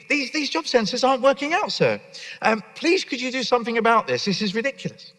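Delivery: mock upper-class English accent with "sir" and "please"; posh Henley accent. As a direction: put on a plummy Henley accent, begging his MP. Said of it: "I've got to work on that".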